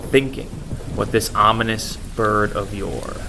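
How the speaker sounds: plain reading voice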